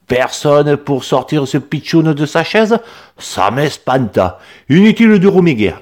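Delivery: put-on accent du Midi, plus haut et sonore; il annonce sa solution miracle; fort accent méridional. A narrator's reading. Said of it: "avec un fort accent méridional". (paysan provençal sûr de lui)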